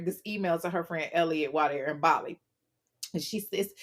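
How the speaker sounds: ordinary talk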